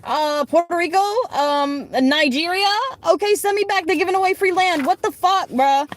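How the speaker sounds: high, sarcastic mock confusion. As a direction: act baffled, dripping with sarcasm. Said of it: "I was like".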